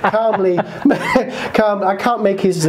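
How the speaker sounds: real laughter breaking into a line that keeps cracking up; can't get the words out for laughing; giggling while trying to voice the puppet; laughing through it. Real, not acted. Breaks up mid-story voicing Freddy.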